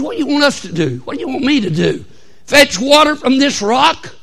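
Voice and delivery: upset Moses. Acted. Moses to the complaining Israelites at the rock, acted upset. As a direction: act out Moses losing patience. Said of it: "Moses gets all upset with them".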